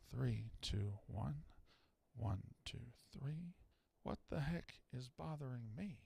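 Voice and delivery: counting low and soft under the breath; quiet mic check. Shown as a demonstration. Host tests the mic, counting quietly. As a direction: murmur the count gently, barely voiced. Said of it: "mic check counting, softer".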